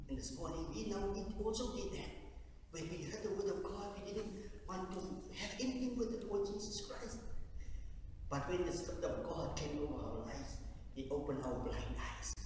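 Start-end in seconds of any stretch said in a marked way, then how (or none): none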